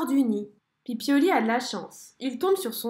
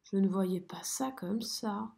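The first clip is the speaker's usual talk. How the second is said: bas et doux, déçu; 4 semitones lower, 6 dB quieter than usual. A narrator's reading. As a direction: avouer sa déception. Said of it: "déçu". (souris déçue, voix basse)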